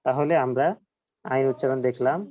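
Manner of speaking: ordinary talk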